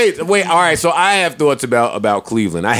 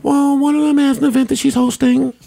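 put-on higher Muppet-like girlfriend voice; squeaky put-on woman voice; man doing a woman's voice; 9 semitones higher than usual. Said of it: "You dating a Muppet baby".

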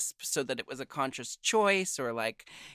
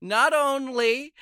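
excited build; 10 semitones higher, 7 dB louder, slower than usual. Real, not acted. Hyping up guest.

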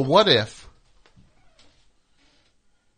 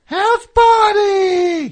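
shout; 13 dB louder, much slower than usual. Acted. Shouting the show name.

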